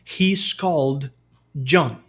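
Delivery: very slow, deliberate teaching example. Shown as a demonstration. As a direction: lay out every word slowly.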